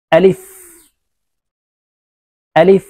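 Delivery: আলিফ said short and quick with breath, no lengthening; Arabic letter alif. Shown as a demonstration. Teacher demonstrating Quranic letter আলিফ, short. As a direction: say আলিফ briefly with air escaping, not stretched. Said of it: "আলিফ উচ্চারণ করার সময় টান হবে না".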